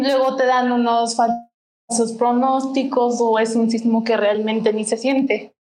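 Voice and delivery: ordinary talk, nothing marked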